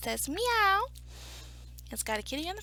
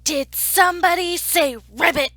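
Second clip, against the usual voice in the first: loud, incredulous question; mock indignation; 5 semitones higher, 12 dB louder than usual. Story narrator catching the stray frog, loud mock indignation. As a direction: demand to know who said it.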